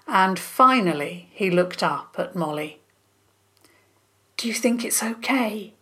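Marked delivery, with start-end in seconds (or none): 4.4-5.7 hissed unvoiced question; whisper yelling. A narrator's reading. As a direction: hiss it under your breath. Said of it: "he hissed".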